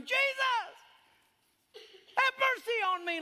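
high cry to heaven with a laugh in it; hammed-up shout for mercy; half-laughing mock wail to Jesus; mock desperate scream. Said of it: "you have to scream to him".

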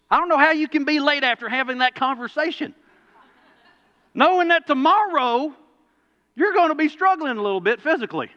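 preacher marveling at the late student; exasperated outburst. Real, not acted. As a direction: throw up your hands in disbelief. Exasperated preacher about late kid.

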